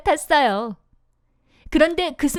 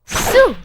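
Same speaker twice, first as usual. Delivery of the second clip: high, loud growing noise; 9 semitones higher, 7 dB louder, much slower than usual. Acted. Nose growing sound.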